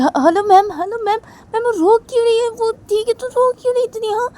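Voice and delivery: put-on very high, loud child's voice; panicked little girl. Little girl on the phone, panicking about the crying child. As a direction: call out desperately like a scared child.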